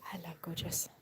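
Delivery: soft whisper to the cow; hushed hello to a calf. Real, not acted. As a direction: whisper it close and intimate.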